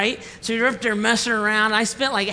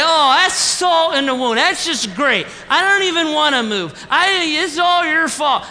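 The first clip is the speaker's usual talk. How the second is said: exasperated outburst; 7 semitones higher, 6 dB louder than usual. Retold or imitated. Retold grumpy outburst at wife.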